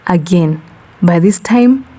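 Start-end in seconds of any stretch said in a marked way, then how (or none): none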